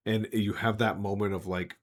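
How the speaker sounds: normal talking voice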